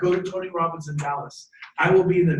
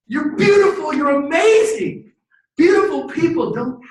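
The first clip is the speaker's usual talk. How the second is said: high, loud gushing praise; big, ringing affirmation; emphatic encouragement; 9 semitones higher, 9 dB louder than usual. Acted. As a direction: shout the compliment like a cheerleader. Cheering on the doubting child.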